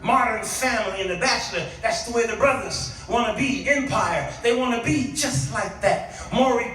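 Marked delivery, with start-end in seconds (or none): none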